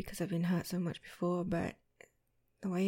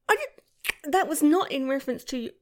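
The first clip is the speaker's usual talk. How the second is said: loud, high flustered protest; 7 semitones higher, 11 dB louder than usual. Acted. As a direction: blurt it out, embarrassed.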